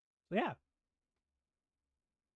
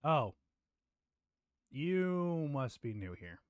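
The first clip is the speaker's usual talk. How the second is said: weary dungeon dweller; much slower than usual. Acted. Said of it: "He's just gonna turn and go".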